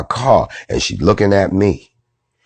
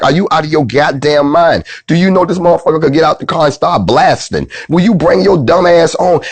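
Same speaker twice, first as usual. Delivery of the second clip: high, urgent mock scolding; exasperated husband; 10 semitones higher than usual. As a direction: act out the husband hustling his wife away. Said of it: "he's trying to pull her away".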